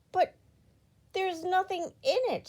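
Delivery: exclaiming. A narrator's reading. Miss Giggles exclaiming at the empty box, astonished. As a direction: sound baffled finding the box empty. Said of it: "she exclaimed".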